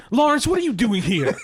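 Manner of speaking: loud, high-pitched, laughing outburst of surprise; hammed-up loud astonishment; mock startled. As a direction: exclaim as if catching an intruder, half laughing. Mock shock at an intruder in character.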